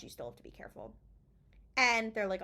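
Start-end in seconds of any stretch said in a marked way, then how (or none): 0.0-0.9 hushed warning; whispering. Retold or imitated. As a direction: whisper it low.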